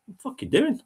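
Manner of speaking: high, incredulous snap at yourself; self-scolding. Retold or imitated. Coming to his senses, scolding himself in a high voice. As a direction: tell yourself off, disbelieving.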